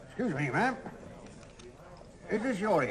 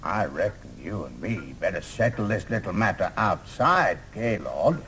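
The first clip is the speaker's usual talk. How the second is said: low cowboy threat; 5 dB quieter, slower than usual. Acted. Challenge to a duel.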